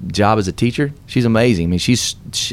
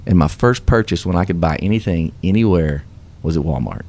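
normal talking voice

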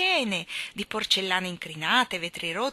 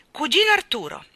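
loud, high call through a door; 9 semitones higher, 9 dB louder than usual. Acted. Mary Poppins calling her cousin through the keyhole, loud. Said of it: "chiamò attraverso il buco della serratura".

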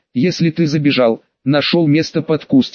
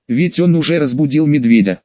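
ordinary delivery

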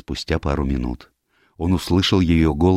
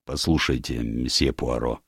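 gruffly reluctant admiral; 4 semitones lower, 5 dB quieter than usual. Acted. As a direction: open a reluctant objection lowered in pitch.

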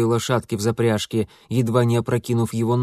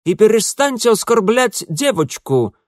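loud, high-pitched female rebuke; indignant; 10 semitones higher, 6 dB louder than usual. A narrator's reading. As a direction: order him indignantly to stop. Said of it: "возмущался женский голос".

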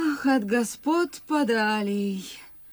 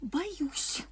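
whisper; 4 semitones higher, 8 dB quieter than usual. Acted. Maid whispering to master at night.

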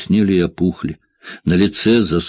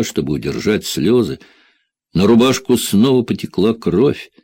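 usual speaking voice

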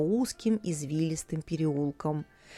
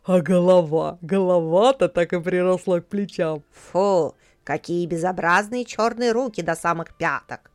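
louder, scornful cry; disgusted jeer; 2 semitones lower, 4 dB louder than usual. A narrator's reading. Crowd member sneering at the dwarf, loud disgust. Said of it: "все вокруг только и кричали".

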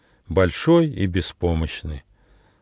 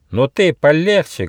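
raised, louder warning; threatening shout; 9 semitones higher, 5 dB louder than usual. Acted. Friend with boomerang warns off the monkey, threatening. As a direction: warn sharply, pitched up and menacing. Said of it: "грозно крикнул Рыж".

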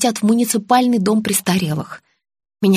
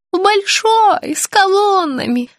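put-on high, slow, childlike pleading; confused old woman; 10 semitones higher, slower than usual. A narrator's reading. Sick woman begging to see her child, confused high voice. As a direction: plead slowly in a high little voice. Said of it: "согласилась Наталья".

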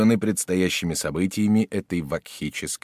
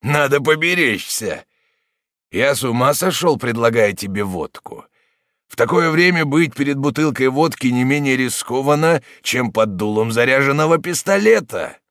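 higher, louder mock caution; taunting friend at the table, raised voice; deliberately raised voice; 7 semitones higher, 7 dB louder than usual. A narrator's reading. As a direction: raise the voice on purpose so all hear. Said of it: "нарочно возвышая голос".

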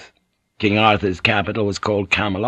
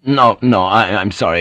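ordinary delivery, nothing marked

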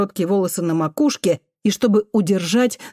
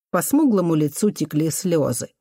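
ordinary delivery

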